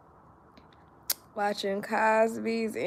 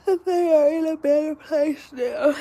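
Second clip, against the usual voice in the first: high, tearful and louder; fighting back tears; voice breaking; 6 semitones higher, 5 dB louder than usual. Real, not acted. Grieving, voice breaking.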